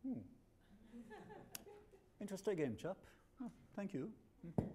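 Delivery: high, soft, laughing British politeness; hammed-up polite Englishman, chuckling; mock British accent. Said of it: "the British will go".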